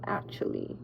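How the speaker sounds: whispered aside; confiding whisper. Real, not acted. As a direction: drop to a secret whisper. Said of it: "whispered aside".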